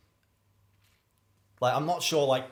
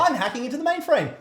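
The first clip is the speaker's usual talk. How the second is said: much higher, eager tech nerd; nerdy hacker voice; 13 semitones higher, 5 dB louder than usual. Acted. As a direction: squeak it like an excited hacker. Acting out an IT guy scene.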